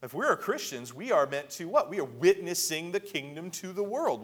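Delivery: usual speaking voice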